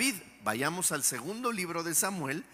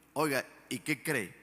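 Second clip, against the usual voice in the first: ordinary delivery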